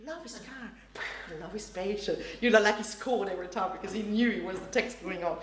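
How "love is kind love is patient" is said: much softer, murmured along under the breath; mouthing along. Retold or imitated. Wedding crasher murmurs along with the reading, much softer. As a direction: mouth the words quietly along with the reader. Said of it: "And he goes".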